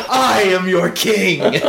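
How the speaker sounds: slow grand decree through laughter; roaring tyrant king. Retold or imitated. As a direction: proclaim it like a mock king. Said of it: "I stood up and went".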